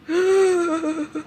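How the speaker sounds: high, tearful gasp. Real, not acted. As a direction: gasp sharply, overwhelmed. Overwhelmed by little left.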